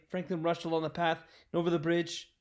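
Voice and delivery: plain reading voice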